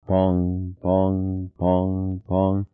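slow even pongs like sonar pings. A narrator's reading. Narrator voices the sonar pinging in the sub. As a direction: intone each pong as a sonar ping.

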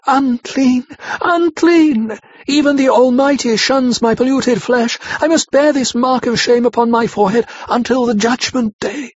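high, loud anguished wail of shame. A narrator's reading. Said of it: "she wailed out".

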